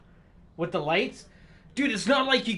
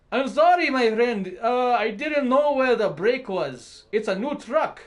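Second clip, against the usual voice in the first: much higher, louder put-on voice for the sorry trucker; mock trucker apology; 4 semitones higher, 4 dB louder than usual. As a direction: play the trucker sheepishly apologizing. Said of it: "dead serious".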